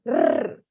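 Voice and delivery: long rolled rrr like a toy motorbike; trilling rrr demo; trilled R sound. Shown as a demonstration. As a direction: make a child's motorbike noise with the tongue. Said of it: "Seria mais ou menos assim".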